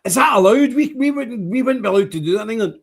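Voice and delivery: high, hurried, anxious callers' voice; quick, high mock alarm; imitating worried callers. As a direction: play the alarmed English fans on the phone. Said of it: "They say".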